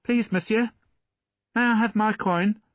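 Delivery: put-on high, polite little boy asking for his coin; small boy. A narrator's reading. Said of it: "The boy".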